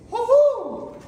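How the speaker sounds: high whooping cheer; high triumphant woohoo. Real, not acted. Cheering the cut piece.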